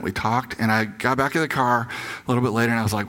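usual speaking voice

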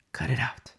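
unvoiced whisper, firm; quiet whispered warning to stop; whispered rebuke. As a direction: hiss it like a stern aside. Preacher scolding softly.

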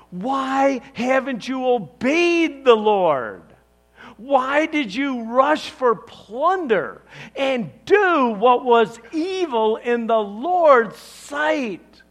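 each question driven hard and slow; passionate rebuke. Acted. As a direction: confront him with righteous passion. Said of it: "Listen to the passion".

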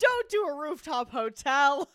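loud, high, drawn-out shout; mock outraged yell. Co-host protesting a tasteless rooftop idea, mock outraged yell. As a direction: holler it in exaggerated protest. Mock outcry at bad suggestion.

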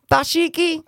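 high, squealed one-word quote; movie catchphrase. Retold or imitated. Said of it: "They say it so many times".

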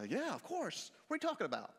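high-swinging mock-baffled reply; puzzled assurance. Peter answers Jesus asking if he loves him, puzzled. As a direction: answer as if the question were absurd.

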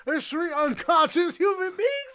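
high, loud, drawn-out mock holler; calling after someone. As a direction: holler in disbelief for the cops' attention. Acts out calling after departing cops.

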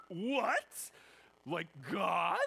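high, loud, disbelieving gasp of a question; shocked. Retold or imitated. Listener hearing Jesus snap at his mother, high and shocked. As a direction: react as if scandalised by what he said. Shocked reaction to rude son.

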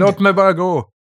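high begging plea; pleading. Acted. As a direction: beg to be let go. Prisoner begs.

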